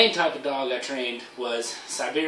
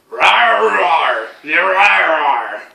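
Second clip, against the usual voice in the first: loud, higher mock growling; hammed-up bear roar; 4 semitones higher, 10 dB louder, much slower than usual. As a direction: roar like a cartoon bear squaring up.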